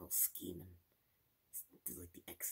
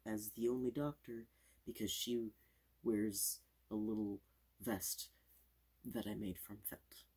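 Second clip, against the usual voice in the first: fast aggressive asmr; 3 semitones higher, 6 dB louder than usual. Acted. Fast loud ASMR showing.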